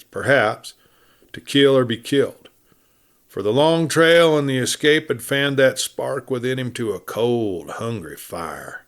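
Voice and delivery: ordinary delivery, nothing marked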